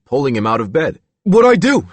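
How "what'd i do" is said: high frightened plea, louder; a scared boy begging. A narrator's reading. A boy begs the staff who drag him from bed, frightened. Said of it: "he begged".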